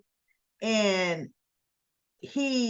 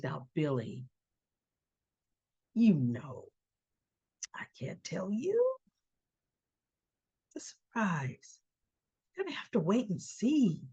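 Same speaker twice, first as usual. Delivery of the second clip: hushed, secretive retold quote; acting out a quietly cagey schemer; cool and calm; 2 semitones lower, 6 dB quieter than usual. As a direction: keep it soft and teasing, part whispered. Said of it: "'Cause Ashley is cool and calm".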